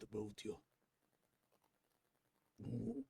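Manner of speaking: a gently probing Irish leader; Irish accent. Acted. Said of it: "Irish is what I believe I want to go for".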